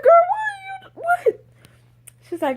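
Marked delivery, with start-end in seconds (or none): 0.0-1.4 very high, loud incredulous squeal; incredulous screech. Retold or imitated. Boss demanding answers from employee, incredulous screech. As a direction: shoot way up into a dragged-out screech. Escalating reaction to employee, retold.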